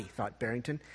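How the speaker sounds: plain reading voice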